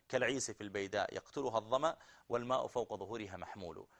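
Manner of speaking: ordinary delivery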